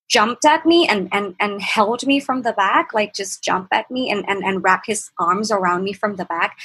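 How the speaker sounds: normal talking voice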